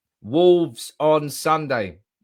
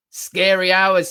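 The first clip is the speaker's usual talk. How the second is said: hyped; 6 semitones higher, 7 dB louder, much slower than usual. Real, not acted. Hyped quote of Sancho.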